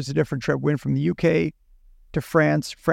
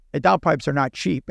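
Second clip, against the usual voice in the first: ordinary talk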